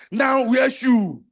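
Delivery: slow, loud shout; shouted command. Real, not acted. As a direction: bellow it at the crowd. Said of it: "shouted command".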